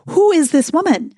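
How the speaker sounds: high, laughing bewilderment; amused disbelief. Retold or imitated. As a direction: ask it while laughing at yourself. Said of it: "I was like".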